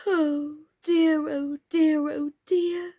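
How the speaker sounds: high, repeated little wail; fretful moaning. A narrator's reading. Mr Plantagenet moaning at the mildewed house, high and fretful. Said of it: "Stop saying 'oh dear'".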